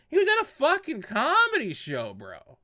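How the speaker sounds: exasperated outburst. Real, not acted.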